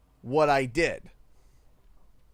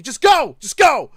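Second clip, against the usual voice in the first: principal's order; 6 semitones higher, 12 dB louder than usual. Retold or imitated. Principal orders him away.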